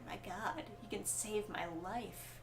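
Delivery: hushed, breathy mock amazement; awed hush. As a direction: whisper it like an impressed crowd. Acted awed reaction of everyone.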